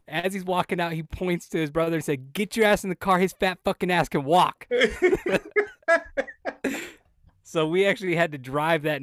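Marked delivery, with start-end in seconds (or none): 2.3-4.7 loud, high furious yelling at his brother; angry yell. Retold or imitated. Angry brother yelling in tattoo shop. 4.7-6.9 high loud burst of laughter; big laugh. Real, not acted. Laughing at the story's punchline.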